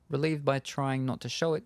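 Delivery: ordinary talk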